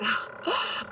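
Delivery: breathy laugh, high; quick giggle. Real, not acted. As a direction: laugh at herself. Laughs at own slip.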